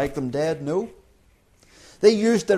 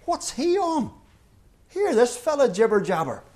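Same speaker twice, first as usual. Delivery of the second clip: high-pitched, incredulous workman; a much higher voice, baffled by the gibberish; baffled builder; 7 semitones higher than usual. Acted. A builder on the scaffolding, high and baffled at his workmate.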